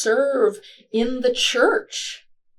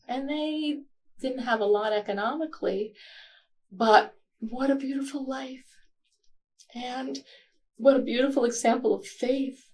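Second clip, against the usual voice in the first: higher and softer, close to tears; choked up; 5 semitones higher, 4 dB quieter than usual. Real, not acted. Honouring her grandparents' faithful life, tearful and soft. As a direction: hold back tears as you praise them. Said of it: "Getting a little choked up here".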